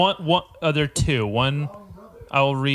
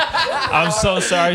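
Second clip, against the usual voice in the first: giggling, slowed apology; laughing apology; 4 semitones higher, slower than usual. Real, not acted. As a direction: say sorry through laughter. Breaking off the ad, laughing.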